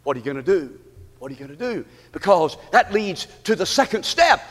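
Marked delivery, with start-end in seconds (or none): 0.0-1.8 low, soft pulpit challenge; hushed question. Real, not acted. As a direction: drop down and ask it pointedly. Hushed question after enemy's whispers.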